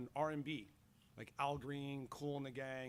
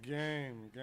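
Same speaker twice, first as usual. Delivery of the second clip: mock reluctant; 4 dB louder than usual. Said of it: "It's like".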